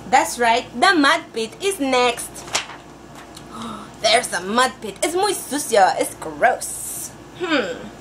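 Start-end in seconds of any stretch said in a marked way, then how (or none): none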